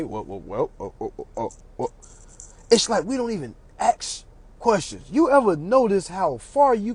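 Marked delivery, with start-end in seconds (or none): 0.0-1.9 low, quiet flustered stammer; low stammering judge. Retold or imitated. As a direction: stammer like you're caught.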